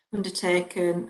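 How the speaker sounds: ordinary delivery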